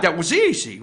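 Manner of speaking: normal talking voice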